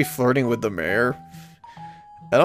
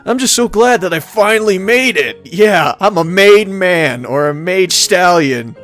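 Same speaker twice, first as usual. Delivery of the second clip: loud, playful triumphant boast; big, raised voice, playing thrilled; hammed-up elation; 5 semitones higher, 6 dB louder than usual. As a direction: crow about it with mock pride. Playing the newcomer, glad to arrive.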